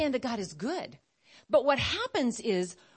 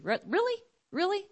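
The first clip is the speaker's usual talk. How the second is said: high, rising disbelief; mock-astonished double take; incredulous; 9 semitones higher than usual. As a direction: question it in a pitched-up squeak. Incredulous mock reaction to Eve.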